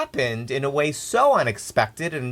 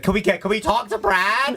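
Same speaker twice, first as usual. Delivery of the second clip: mimicking eager callers; 10 semitones higher than usual. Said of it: "I'd be like".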